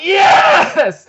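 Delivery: high, loud fan cheer; excited shout. Real, not acted. Fan reacts to Escanor's entrance, ecstatic shout. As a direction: build into a huge, high yell of triumph.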